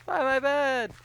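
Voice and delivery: high, loud squeaky joke voice; squeaky mock line. Friend squeaking a silly catchphrase at the others. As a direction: squeak the punchline.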